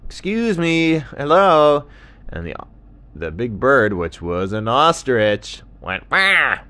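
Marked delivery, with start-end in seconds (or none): 0.1-1.9 high, loud hail; sing-out dingo greeting; dingo calling out. Acted. Dingo calls to buried ostrich. 6.1-6.6 high, loud "wah"; startled ostrich squawk. Acted.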